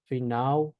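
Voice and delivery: final said as "fi-NAU"; Portuguese final L. Shown as a demonstration. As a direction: turn the l of final into a u glide. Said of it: "Que sería".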